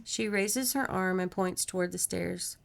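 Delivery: ordinary delivery, nothing marked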